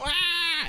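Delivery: high mock scream of a waking child; child's wail. Imitating a child screaming until it gets its way, high wail. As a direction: wail like a toddler who wants something. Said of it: "they go".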